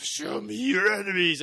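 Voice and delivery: a hint of a gruff growling wolf; mock gruff werewolf. Imitating the American werewolf, a lightly gruff voice. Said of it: "it's so gruff".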